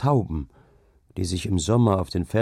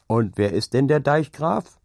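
higher-pitched challenging question; raised, rising demand; calling out; 6 semitones higher than usual. A narrator's reading. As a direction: call the question to the whole room. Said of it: "rief Ole Hensen wieder".

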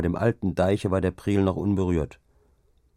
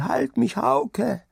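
very high, desperate plea; crying out; much slower than usual. A narrator's reading. Sick wife calling to her husband in delirium, crying out. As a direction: call out high in feverish panic. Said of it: "schrie sie".

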